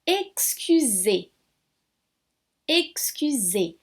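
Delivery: excusez with the final E held long and slow; teacher drawing out each syllable of excusez; stressed final E. Shown as a demonstration. Teacher modelling French for students, slow and drawn out. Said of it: "eu dou ênfase nesse meu E".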